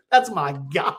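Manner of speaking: chuckling retold text; laughing quote. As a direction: read through laughter. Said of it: "Terrell texts her back and goes".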